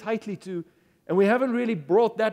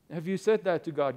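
ordinary talk, nothing marked

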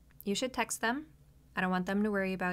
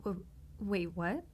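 surprised stammer; 2 semitones lower than usual. Acted. Stunned by partner's news.

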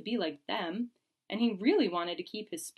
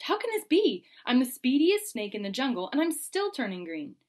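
high and loud in disbelief; shocked dismay; 5 semitones higher, 5 dB louder than usual. Acted. Verdi finding green skin, crying out in high, loud shock. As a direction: cry out, horrified at the green.

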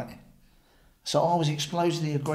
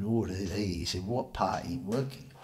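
put-on droll Scottish accent; 6 semitones lower, 7 dB quieter than usual. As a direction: imitate a droll Scot, unhurried. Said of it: "his droll Scottish accent".

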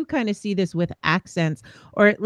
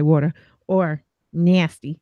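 New York vowels on "water" and "nasty"; undropped New York accent; 5 semitones lower than usual. Shown as a demonstration. Showing words where the accent has not dropped, New York accent. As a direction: let the New York accent show on each word. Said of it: "my accent has not dropped at all".

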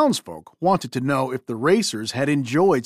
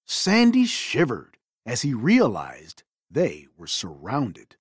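ordinary delivery

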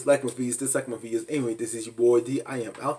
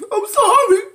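whiny mock apology; 9 dB louder than usual. Mocking Scott's whiny apology.

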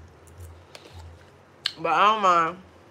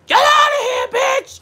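high, loud mock screech of an angry auntie; imitating a yell; 5 dB louder than usual. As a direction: yell it like the auntie kicking you out. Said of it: "you ain't have to yell at me like that".

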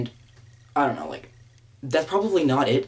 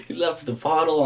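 higher, breaking out of a laugh; 5 semitones higher than usual. Real, not acted. Vlogger recounting a contestant's blunder, laughing. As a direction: tell it through laughter. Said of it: "I hate to laugh at that".

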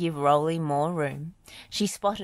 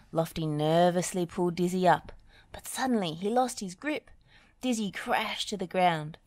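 usual speaking voice

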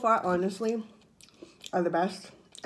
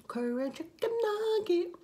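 squeaky high voice; 13 semitones higher than usual. Acted. High odd voice on nugget.

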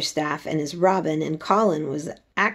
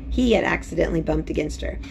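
ordinary delivery

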